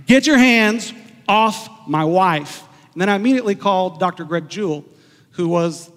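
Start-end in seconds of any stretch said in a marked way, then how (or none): 0.1-2.6 slow, loud, forceful reenactment; a slow, raised warning to the nurse; retold stern command. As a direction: firmly order him off, slowly and loudly. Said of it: "colorful words also as I said that".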